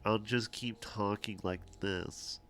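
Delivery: talking with a pinched nose; stuffy plugged-nose voice. Real, not acted. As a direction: hold your nose and keep chatting. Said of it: "If I talk like this with my nose plugged".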